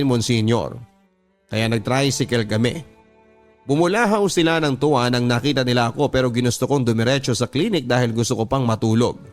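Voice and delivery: ordinary talk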